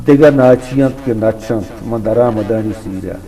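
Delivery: declaimed couplets, openings lifted and stretched; ghazal recitation. Acted. Poet repeating couplets for an admiring host, rhythmic declamation. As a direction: recite with a measured lilt, savouring each line.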